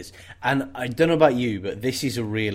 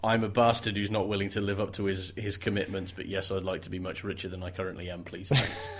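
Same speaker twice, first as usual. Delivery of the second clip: soft, low, sneaky confession; hushed villain aside, a bit lower; sly muttered aside; 5 semitones lower, 9 dB quieter than usual. Retold or imitated. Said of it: "whatever the sign language is for".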